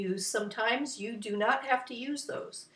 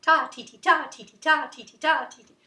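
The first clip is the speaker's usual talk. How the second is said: quick, loud rhythmic chant; rapid-fire ta-ti-ti, louder; fast tempo; 4 semitones higher, 7 dB louder, faster than usual. Shown as a demonstration. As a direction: rattle off the beats, punching each 'ta'. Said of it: "or a fast tempo".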